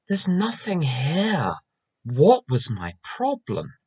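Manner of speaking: slow, drawn-out puzzlement, starting high; mock bafflement. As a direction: act out baffled relief at overreacting. Baffled self, rereading email next day.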